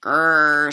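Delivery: loud "grrr" growl; fish's growl. A narrator's reading. Big fish outwitted by the father, growling. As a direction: growl it out, annoyed.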